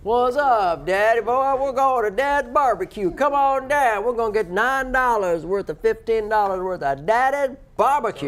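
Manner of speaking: put-on hammy Southern accent, shouting the ad. Southern accent hyped to Daddy's barbecue listeners. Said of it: "try to imitate a Southern accent".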